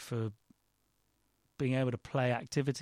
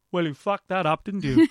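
mock tutor voice, louder and higher; deadpan the crushing verdict; blunt put-down; 6 semitones higher, 10 dB louder than usual. Tutor telling off a student after audition, mock scornful. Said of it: "And he went".

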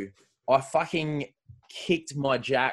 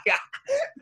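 sudden high cackle; high burst of laughter. Real, not acted. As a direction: cackle at his own joke. Laughs at own crude joke.

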